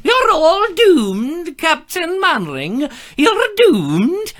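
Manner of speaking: slow, high doom-laden wail; slow ominous raspy drawl. Retold or imitated. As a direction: wail the warning slowly. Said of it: "just getting into the mood".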